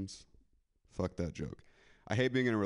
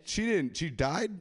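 surprised; 7 semitones higher, much slower than usual. Real, not acted. Surprised at death.

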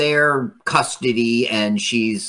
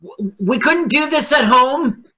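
mock whiny husband; 10 semitones higher than usual. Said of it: "you turn to your wife and be like".

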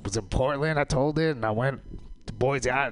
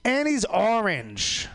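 mocking people; 8 semitones higher, much slower than usual. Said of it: "People be like".

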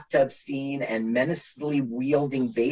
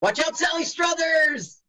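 high, playful warning call; mock warning cry; 14 semitones higher than usual. Mock warning to film extra.

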